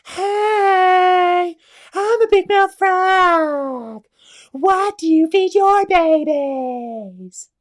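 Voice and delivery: very high, very loud, slow squealing frog; big mouth frog voice. Acted. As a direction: shout it like a huge-mouthed cartoon frog. Frog greets snake.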